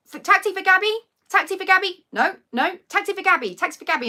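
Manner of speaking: loud, high call across a street; calling out for a taxi. Retold or imitated. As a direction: call out to find your driver.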